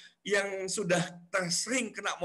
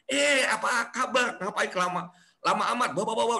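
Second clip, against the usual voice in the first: excited extrovert; 7 semitones higher than usual. Retold or imitated. Extrovert friend answering a greeting, high and gushing. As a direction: burst out like a thrilled old friend.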